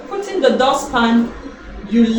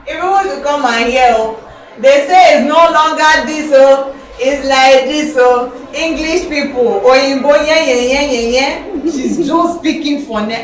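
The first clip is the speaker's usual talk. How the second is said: loud, raised-pitch mocking jeers; making fun; 3 semitones higher, 8 dB louder than usual. Brothers jeering at sister's English, loud and making fun. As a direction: call everyone over to ridicule her, loudly. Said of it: "they'll make fun".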